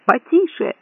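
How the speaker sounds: high, pleading yelp; howled protest. A narrator's reading. Man to raging companion, yelping. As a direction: beg him urgently to ease off. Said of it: "взвыл Лафайет".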